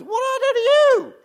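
pastor's silent protest on the phone, high and loud; exasperated inner cry. Retold or imitated. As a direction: let the hurt burst out in a high cry. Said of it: "what I was thinking is".

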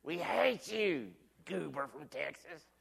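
imitating the crowd, loud high jeer fading to a sneer; mock hostile crowd. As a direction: play the annoyed parade crowd, shouting then trailing off. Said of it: "they're all looking at me like".

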